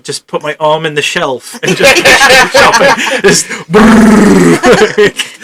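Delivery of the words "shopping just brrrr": high laughing brrrr; gleeful shopping sound effect. Pretend. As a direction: sweep the shelves with a squeal. Mimes frantic shopping spree.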